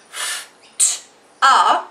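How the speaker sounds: f, t, a each sound held apart; sounded out slowly. Shown as a demonstration. Teacher sounding out after for pupils. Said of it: "in which case I say a".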